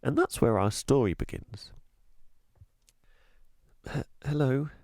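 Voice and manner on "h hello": a little higher, stammered and unsure; shy girl greeting a stranger, hesitant; girl nervously greeting the tramp, stammering; nervous hello. A narrator's reading. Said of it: "her voice trembling a little with nerves".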